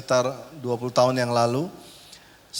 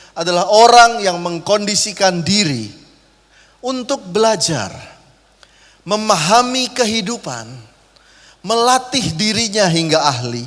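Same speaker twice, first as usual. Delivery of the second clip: preaching loud; 5 semitones higher, 7 dB louder than usual. Real, not acted. Preacher building to climax.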